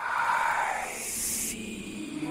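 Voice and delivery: breathy slow ghostly whisper; eerie whisper. Acted. Ghost whispering to the startled player. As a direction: whisper it slowly like a lurking ghost.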